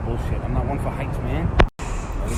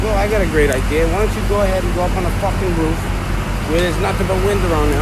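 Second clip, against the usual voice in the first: loud, high sarcastic boss voice; mocking the boss; 6 semitones higher, 6 dB louder, faster than usual. Worker mimics his boss ordering him onto the roof, loud and mocking. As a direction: ham up the boss's bright idea. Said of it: "The boss is like".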